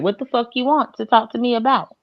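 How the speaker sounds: normal talking voice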